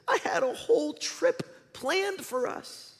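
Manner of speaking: high, slow, crestfallen cartoon voice; Bing Bong. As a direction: drag it out in a high, wounded whine. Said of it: "And Bing Bong says".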